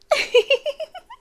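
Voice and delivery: a light, breathy high laugh; quick airy chuckle. Acted. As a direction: let a small amused laugh slip. Amused laugh mid-confession.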